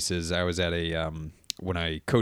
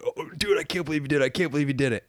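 put-on excited kids; 6 semitones higher, faster than usual. Kids reacting to goldfish stunt.

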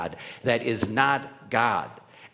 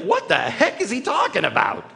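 a higher, louder indignant outburst; indignant Randy; 9 semitones higher, 6 dB louder than usual. Acted. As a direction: protest as the one who got punched. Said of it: "Randy's like".

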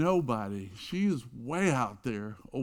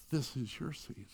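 much softer, gentle invitation; tender offer; 9 dB quieter than usual. Retold or imitated. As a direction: offer it like a gift. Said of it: "Jesus ... come to you and say".

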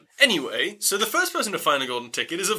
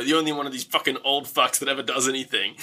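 usual speaking voice

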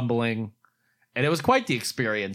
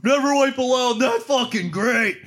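imitating a delighted shout, very high and loud; thrilled outburst; 13 semitones higher, 5 dB louder than usual. Kane reacts to the fans' shirts, thrilled outburst. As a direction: draw out an acted, overjoyed high yell.

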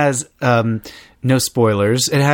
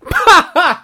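high giggle; 14 semitones higher, 5 dB louder than usual. Real, not acted. Laughing after being tickled.

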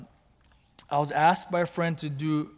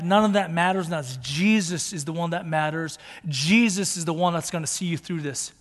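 raised, emphatic sermon voice; impassioned preaching; 3 semitones higher, 6 dB louder than usual. Acted. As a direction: preach it with rising conviction. Preaches at full force.

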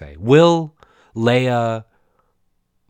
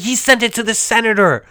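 louder, higher put-on boss; 9 semitones higher, 3 dB louder than usual. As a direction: play the scandalised boss. Boss voice in retold scene.

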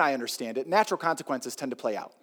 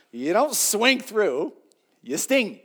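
slow, higher and louder; emphatic repeat; 6 semitones higher, 5 dB louder, much slower than usual. Shown as a demonstration. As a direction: hammer the rule home. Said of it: "repeat with emphasis".

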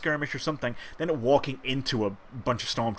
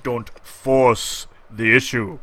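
mock Vader menace; 6 dB louder, much slower than usual. Mock Vader line to critic.

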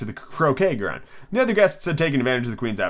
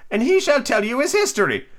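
Queen voice; 12 semitones higher than usual. Acted. Queen voiced in read story.

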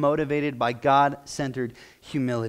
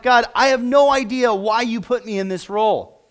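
retold crying out; 7 semitones higher, 5 dB louder than usual. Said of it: "I remember crying out".

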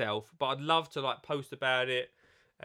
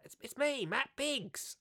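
much higher put-on voice; Matt Biggs impression; 10 semitones higher, 3 dB quieter, slower than usual. Reading the book blurb aloud, mimicking Matt Biggs, high put-on voice. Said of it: "shall I do the last bit in his bit?".

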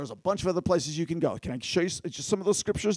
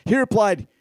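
normal talking voice

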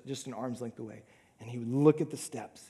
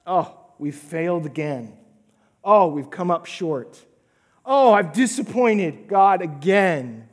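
self-condemning lament; 3 semitones higher, 9 dB louder than usual. Retold or imitated. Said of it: "feel those words in a condemning way".

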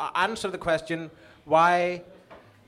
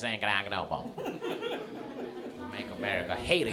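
low, muffled Trump impression; a hint of a gruff Trump; 8 semitones lower, 7 dB quieter, faster than usual. Imitating Trump slogan.

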